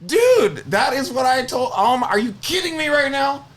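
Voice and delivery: high, loud, indignant outburst; retold exasperated yelling. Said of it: "I started yelling".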